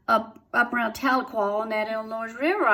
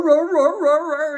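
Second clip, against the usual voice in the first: dogs roaring; 5 semitones higher, 6 dB louder than usual. Retold or imitated. Imitating dogs baying.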